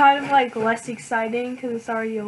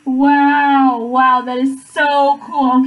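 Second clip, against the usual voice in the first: loud, excited wow, much louder than her chat; loud excited unboxing gasp of wonder; really loud wow; 10 dB louder, much slower than usual. Real, not acted. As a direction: blurt it out loud in delight. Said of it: "that just got really loud".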